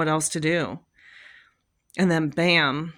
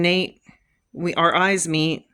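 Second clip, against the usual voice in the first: ordinary delivery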